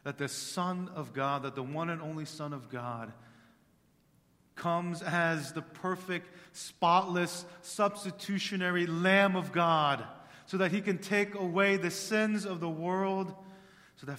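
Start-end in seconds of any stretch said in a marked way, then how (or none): 4.5-13.4 loud, high impassioned sermon; fervent pulpit proclamation; full-force preaching. Acted. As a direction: cry it out to the congregation.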